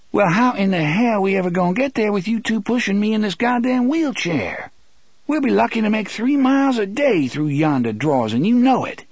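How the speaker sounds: high, quick, indignant character voice; exasperated Susanna. Acted. Susanna complaining to Roland about the wheelchair, exasperated. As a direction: complain in a high-pitched, fast rush.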